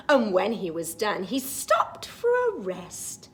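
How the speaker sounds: put-on lively read-aloud for children; storybook reading. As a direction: read it like a bedtime picture book. Reads the picture book rhyme.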